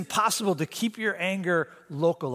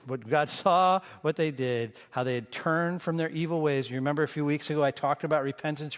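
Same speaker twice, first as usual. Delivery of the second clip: usual speaking voice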